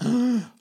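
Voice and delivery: loud, high intake of breath; mock gasp of amazement; imitated shocked gasp. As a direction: gasp sharply, acting out her shock. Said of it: "she'd held her breath and went".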